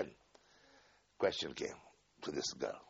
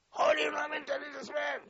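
retold shout; faster than usual. Heated interrogation quarrel.

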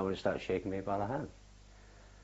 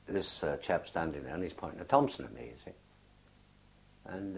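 usual speaking voice

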